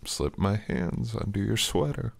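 ordinary talk

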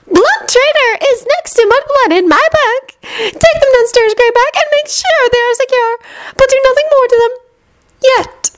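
high, cruel witch voice; Bellatrix voice. A narrator's reading. Bellatrix ordering Greyback.

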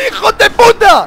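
very high, loud and slow curse; furious shout. Real, not acted. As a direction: scream it in rage at the game. Muere de nuevo, furioso. (grito furioso)

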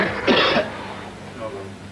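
low, heaving retch; retching cough. Real, not acted. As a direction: retch after exertion. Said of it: "If you want to be sick".

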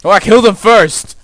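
loud, high gleeful realisation; excited victory yell; triumphant shout. Real, not acted. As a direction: crow about the win at full voice. Realises the kill counted.

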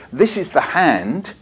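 slow, measured call-and-response line; poem recital. Shown as a demonstration. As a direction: recite slowly for children to echo. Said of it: "exactly as I do it".

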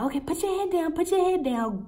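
high-pitched mimicked assistant; assistant's urging. Voices assistant's hurried instructions.

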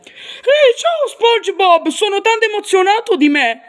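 hammed-up goofy starfish; Patrick voice. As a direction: call out to your best friend all thrilled. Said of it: "Mi chiamo Patrick Stella".

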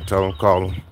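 ordinary talk

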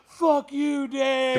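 high, drawn mock holler; retold crowd yell. Old audience yells at promoter, mock holler. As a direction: imitate a crowd calling to the back of a theater. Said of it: "yell it to the back of the theater".

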